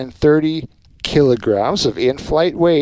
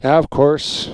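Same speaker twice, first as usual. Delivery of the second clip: ordinary delivery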